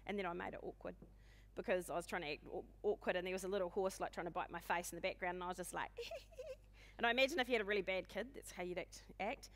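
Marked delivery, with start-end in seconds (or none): 6.0-6.5 tiny high-pitched squeak, very soft; mock nervous squeal. Speaker acting out herself on a call as pony attacks, mock nervous squeal. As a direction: squeal softly and high, imitating your helpless reaction. Imitates own reaction to biting pony.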